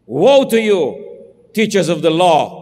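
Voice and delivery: loud, ringing scripture woe; thundering rebuke. Acted. Preacher reads Jesus' woe to the Pharisees in a loud pulpit boom. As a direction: call out the hypocrites from the pulpit.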